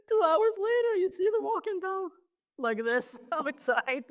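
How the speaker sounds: high, breaking into laughter; laughing. Real, not acted. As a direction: talk through the laughter. Laughing while telling punchline.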